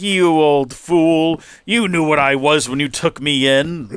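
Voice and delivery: mock-menacing dragon savoring the betrayal; Toothless the villain. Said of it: "He goes like".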